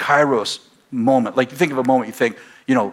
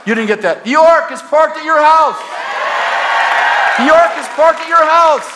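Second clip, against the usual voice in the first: preaching at full force; 6 dB louder than usual. Real, not acted. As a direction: proclaim it to the whole room. Preaching climax.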